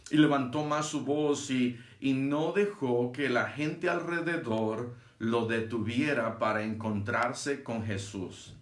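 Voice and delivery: ordinary delivery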